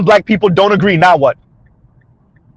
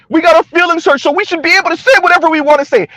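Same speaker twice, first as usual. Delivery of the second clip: mocking entitled voice; 8 semitones higher, faster than usual. Mocking their justification.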